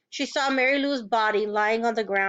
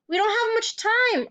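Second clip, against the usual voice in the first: much higher, pressing urgency; urgent; 11 semitones higher than usual. A narrator's reading. Catherine urging the sheriff, urgent and high. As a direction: hurry him, it's urgent. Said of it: "urged Catherine".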